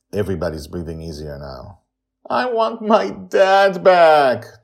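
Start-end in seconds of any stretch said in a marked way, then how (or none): 2.3-4.6 high, slow, loud crying plea; a boy crying for his dad. A narrator's reading. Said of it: "Charlie cries".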